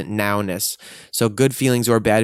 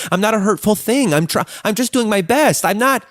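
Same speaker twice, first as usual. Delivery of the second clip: panicked self-defense, pitched way up; frantic defensive protest; 10 semitones higher, 3 dB louder than usual. Retold or imitated. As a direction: voice jumps much higher, louder. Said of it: "and go".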